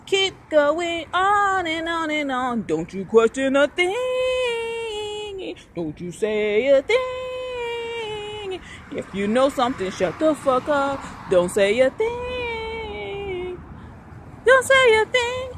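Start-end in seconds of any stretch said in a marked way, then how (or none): 2.7-8.6 mock warning. Mock warning, slow high. 11.3-15.5 mock wail. Repeated, cry heard.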